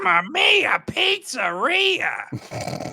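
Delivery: very high, slow sing-song; exaggerated catchphrase demo. Shown as a demonstration. Co-host showing his friend the favor, high drawn-out catchphrase. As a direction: stretch it out high like a cartoon plumber. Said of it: "in your, in your voice".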